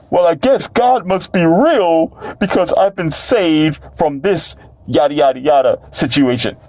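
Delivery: high-pitched mimicry of a grateful convert; put-on high testimony voice; mock believer. Mimics a newly saved believer's testimony.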